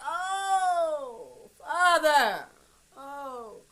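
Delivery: high, loud tearful cry. Real, not acted. Mother crying out to God over her son. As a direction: break down calling on the Father. Overcome imagining son's death.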